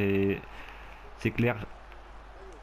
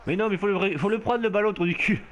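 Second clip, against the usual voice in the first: exasperated outburst; 8 semitones higher than usual. Real, not acted. Player exasperated at game.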